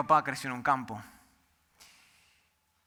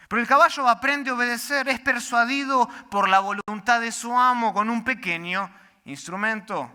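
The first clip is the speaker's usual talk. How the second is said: louder, higher, driving sermon delivery; full-force preaching; 7 semitones higher, 6 dB louder than usual. Acted. Pastor drives home the horse illustration, full-force preaching. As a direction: preach it hard to the back row.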